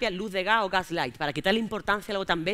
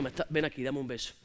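put-on 1 coaxing boyfriend; 8 semitones lower, 8 dB quieter than usual. Boyfriend voiced, making up.

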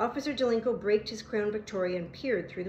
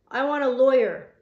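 put-on loud, higher-pitched insistent kid; loud demand; 4 semitones higher, 11 dB louder than usual. A narrator's reading. Caught kid to the policeman who cuffed him, loud demand.

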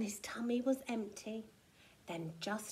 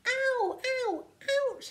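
high, loud yelps; string of sharp pained cries; 13 semitones higher, 5 dB louder than usual. A narrator's reading. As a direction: yelp repeatedly as if hurt. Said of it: "he cried".

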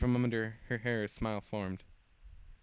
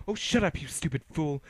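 contemptuous snap; 5 semitones higher, 6 dB louder than usual. Acted. Lucy snaps at her foe.

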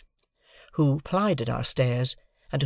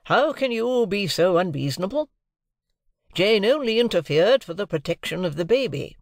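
higher, pleading husband voice; remonstrating Mr Pocket; 7 semitones higher, 3 dB louder than usual. A narrator's reading. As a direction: reason with an unreasonable wife. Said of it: "remonstrated Mister Pocket".

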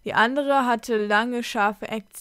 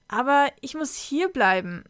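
higher, mildly bewildered protest; confused; 4 semitones higher than usual. A narrator's reading. Sam objects to the wolves' demand, confused. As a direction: object in mild confusion, pitch raised. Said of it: "sagte Sam verwirrt".

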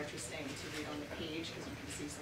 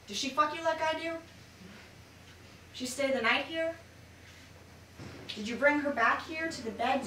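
higher and louder, fast jealous interrogation; spoken word jealous rage; jealous accusation; 5 semitones higher, 7 dB louder than usual. Acted. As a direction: hurl the questions at the lover. Jealous lover voiced in poem.

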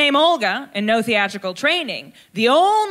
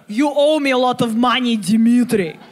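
heavy mock Russian accent, a bit louder; a mobster's threat in a bad Russian accent; 4 dB louder than usual. Comedian doing a bad Russian gangster accent. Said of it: "It's not a great accent".

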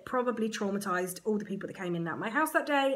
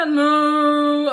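strained no; 5 semitones higher, 12 dB louder than usual. Retold or imitated. Trying to refuse, weird noises.

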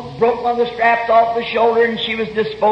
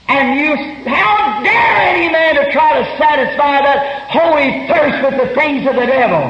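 raised, higher shout; preaching shout; 4 semitones higher than usual. Real, not acted. Preacher challenging the congregation, shouting. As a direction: thunder the question at them.

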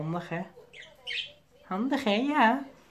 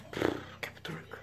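breathy pff then whispered words; whispered sigh; 10 dB quieter than usual. Real, not acted. Vlogger sighing to viewers about his busy schedule, whispered. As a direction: let the busyness out in a whisper.

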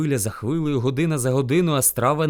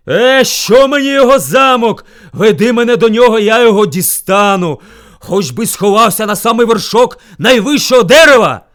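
very loud, high shout; furious boasting bear; 6 semitones higher, 13 dB louder than usual. A narrator's reading. Bear vows to reach his rival, angry shouting. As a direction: bellow scornful defiance.

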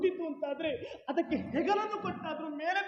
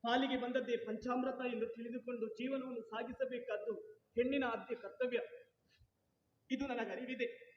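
softer, fast, subdued; quiet earnest; 7 dB quieter than usual. Acted. Wife quietly tells her husband not to say such things. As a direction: hush him with quiet conviction.

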